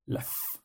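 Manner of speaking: soft voiceless f like a cat; cat-like f hiss. Shown as a demonstration. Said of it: "como un gato".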